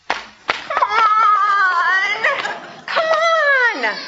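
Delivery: very high, loud wailing come on; whining child. Acted. Kid who has run out of arguments, loud high whining. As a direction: whine like a frustrated toddler.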